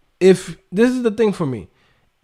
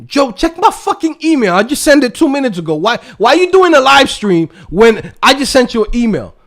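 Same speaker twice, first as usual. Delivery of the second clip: much higher, pestering voice; pushy viewer; 5 semitones higher, 3 dB louder than usual. Retold or imitated. Pushy viewer nagging the streamer mid-stream, higher voice. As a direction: nag him like an impatient fan.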